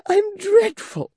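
very high, slow, half-crying wail; mock-despairing Flora. Flora lamenting her looks to Clennam, high mock wail.

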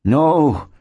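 high, drawn-out protest; settler's cry. A narrator's reading. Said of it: "cried the man".

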